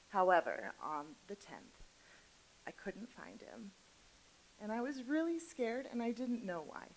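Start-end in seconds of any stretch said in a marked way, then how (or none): 2.7-3.7 whispered, voice dropping away; hushed whisper. Real, not acted. Telling the audience her cat was missing, whispered. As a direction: let it slip out in a frightened whisper.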